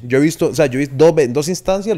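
usual speaking voice

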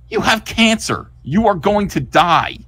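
high, emphatic mock shout; mock-dramatic doom pronouncement; screaming old doctor. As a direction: hammer out the diagnosis, high and slow. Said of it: "an old man screaming".

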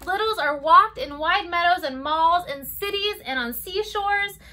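lilting storybook read-aloud; circle-time story voice; reading to children. Acted. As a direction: read it gently to toddlers. Reads picture book to children.

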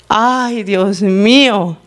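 slow, drawn-out lament; heartbroken exclamation. Real, not acted. Preacher reacts to the slave's plea, heartbroken. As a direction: sigh it out slowly, deeply moved. Moved by the child voice.

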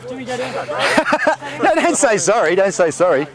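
usual speaking voice

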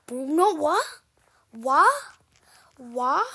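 loud rising what; bewildered yell; shocked cry. Real, not acted. Kid falling off a jump, loud bewildered cry.

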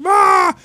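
high loud pained cry; mock scream of pain; imitated scream. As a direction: yell like a man in agony. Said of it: "this guy's like".

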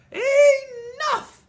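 huge furious holler, high and loud. Acted. Nana hollering at the children to stop, full-force yell. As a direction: bellow it to stop the children cold. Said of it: "Lucille's Nana hollered out real loud".

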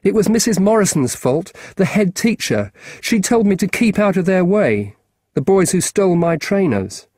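lightly voiced keen schoolboy; a boy asking a grown-up a favour; boy's voice. Marcus voiced higher.

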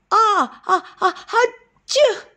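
high, loud building sneeze. A narrator's reading. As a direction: wind up into a big sneeze. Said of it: "R B Armadillo went".

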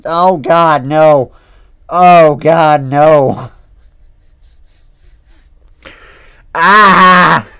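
high, loud pleading cries; real terror, cried out; panicked. Real, not acted. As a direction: beg in real fright. Panicked reaction to scare.

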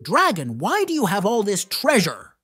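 ordinary delivery

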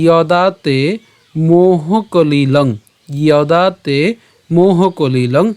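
"যদা তে মোহ কলিলং" very slow, long vowels; slow pronunciation demo. Shown as a demonstration. Teacher modelling the first line for learners, "যদা তে মোহ কলিলং" drawn out. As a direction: stretch "যদা তে মোহ কলিলং" syllable by syllable, y at the start. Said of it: "এর উচ্চারণটা কেমন হবে".

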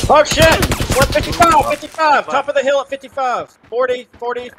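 high, loud urgent callout; excited callout. Real, not acted. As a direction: call the bearing fast and urgent. Calling enemy bearing in firefight.

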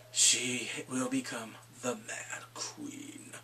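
low, soft, slow pronouncement; ominous prophecy. Acted. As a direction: intone it gravely, drawing each word out. Ominous closing prophecy in recap.

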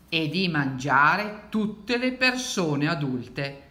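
slow, dramatic read-aloud; deliberate picture-book storytelling; story narration. A narrator's reading. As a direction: build slowly to the wolf's plan.

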